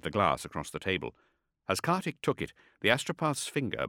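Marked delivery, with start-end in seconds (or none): none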